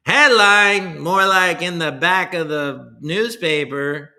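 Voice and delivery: loud, high, slow sneering jab; mock roast. Streamer roasting a token name for chat, loud and mocking. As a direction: dunk on the coin like a heckler.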